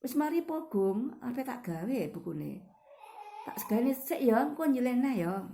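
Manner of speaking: friendly, gentle request, still high; polite asking demo. Shown as a demonstration. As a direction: ask for the book back kindly, no scolding. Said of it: "Tapi lebih enakan kalau kamu bicara".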